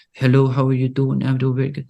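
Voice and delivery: imitating a student with tight, tense lips. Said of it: "really tense lips the whole time".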